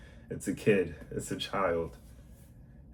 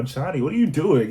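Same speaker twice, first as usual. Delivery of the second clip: much higher and louder what are you doing; mock stern call; 7 semitones higher, 7 dB louder than usual. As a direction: call out to the kid across the room, half-joking. Adult calling out misbehaving child.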